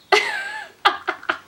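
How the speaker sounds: high burst of embarrassed laughter. Real, not acted. After acting Romeo for viewers, a high embarrassed laugh. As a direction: laugh at yourself after hamming it up. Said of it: "the most ridiculous thing I've ever done".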